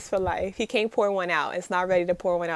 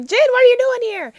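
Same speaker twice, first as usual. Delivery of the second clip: high, loud confused question; shocked to find himself in a friend's car; surprised; 10 dB louder than usual. Retold or imitated. Confused hero waking in his friend's car, surprised.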